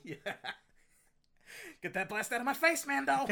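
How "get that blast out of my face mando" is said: put-on loud, high Boston-accented tough-guy yell. Bill Burr's character yelling at Mando in Boston accent. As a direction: bark it like an angry Boston guy.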